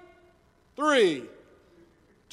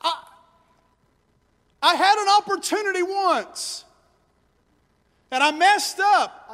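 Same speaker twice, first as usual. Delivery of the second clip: lightly voiced discouraged doubter, higher; voicing the lie; 8 semitones higher than usual. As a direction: act out someone who has given up, a touch higher. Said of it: "Number four".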